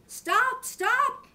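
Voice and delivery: high loud call of stop. A narrator's reading. Horse in the meadow calling out for him to stop. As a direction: shout from the meadow. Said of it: "called the horse".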